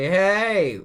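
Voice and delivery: loud high shout; calling out. Acted. Someone calling after the crew, loud and high. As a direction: yell to stop them. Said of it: "they're like".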